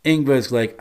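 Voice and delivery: normal talking voice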